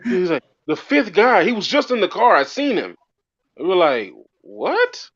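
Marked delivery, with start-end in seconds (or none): 0.7-3.0 high-pitched, insistent retold cop; freaked-out cop. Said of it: "this dude is, like, tripping out". 4.4-5.1 very high, incredulous "what"; retold bafflement. Group baffled by cop's claim.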